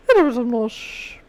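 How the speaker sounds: slow, put-on Irish lilt; mock Irish accent. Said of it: "no accents this week".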